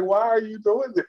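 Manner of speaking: high, mildly hammed-up disbelief; lightly voiced pleading friend; mock exasperated question. Friend begging him to think, mock exasperation. Acting out the car argument scene.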